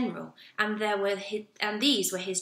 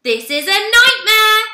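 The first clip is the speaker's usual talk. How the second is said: much higher, much louder, slow dramatic wail; horrified outburst; 8 semitones higher, 14 dB louder, slower than usual. Acted. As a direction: wail it like a true disaster. Fussy manager horrified by pillow.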